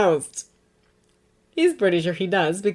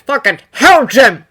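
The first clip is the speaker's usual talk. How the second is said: loud exclamation; 4 semitones higher, 10 dB louder than usual. A narrator's reading. Frank's relieved exclamation.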